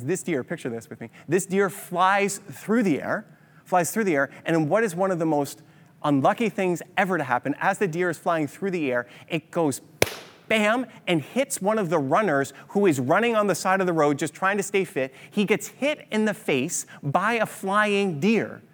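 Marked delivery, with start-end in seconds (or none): 1.3-3.2 drawn-out words, rising at the end; slow, vivid storyteller build; slow dramatic telling. Acted. Picturing the deer mid-flight. 15.3-18.6 each word spaced out for the payoff; slow, deliberate comic reveal; slow punchline. Acted. Punchline of the deer story.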